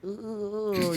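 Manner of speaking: high mock "ugh" groan; a high scoffing grunt in their voice; imitated groan. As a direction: groan up high like the beaten rivals. Other guys reacting, imitated.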